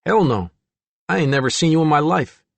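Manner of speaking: put-on surly teenager brushing someone off; a touch of hostile defiance; sullen. A narrator's reading. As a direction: keep her at arm's length, sullenly. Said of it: "Tommy remained sullen".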